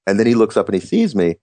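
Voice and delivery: ordinary talk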